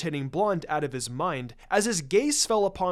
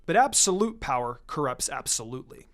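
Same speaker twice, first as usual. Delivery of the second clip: solemn legend reading; 4 semitones lower than usual. A narrator's reading. The narrator reads Hinata's scroll fable, lower and solemn. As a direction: intone it like an old myth from a scroll.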